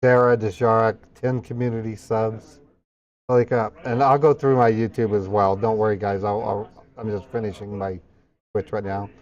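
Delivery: usual speaking voice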